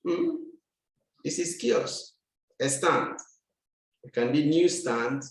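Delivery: usual speaking voice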